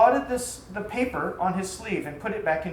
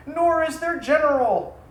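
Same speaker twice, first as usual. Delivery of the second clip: bitter admission; 7 semitones higher, 7 dB louder than usual. Acted. Issa admitting bitterly to himself.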